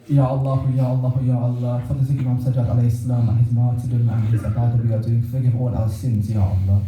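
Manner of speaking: low-pitched, subdued prayer recitation; closing supplication prayer. Real, not acted. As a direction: lead the mourners in a low, solemn plea to God.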